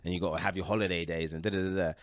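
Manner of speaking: ordinary delivery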